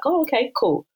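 mock polite recovery; a little shocked. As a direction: imitate covering slight surprise. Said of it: "they're a little bit shocked by it".

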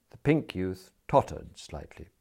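ordinary delivery, nothing marked

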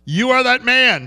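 high booming divine voice. Retold or imitated. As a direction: thunder it out as God. God's booming voice.

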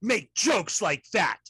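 loud, high, hammed-up indignation; mock outrage. Mocking those who ousted Al Franken from the Senate, hammed-up outrage. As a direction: pronounce a theatrical banishment, pitch leaping on key words.

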